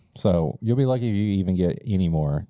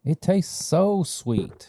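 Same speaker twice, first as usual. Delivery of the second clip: high, slow, blissful; savoring the sweetness; 9 semitones higher, much slower than usual. Real, not acted. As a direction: stretch it out with delight.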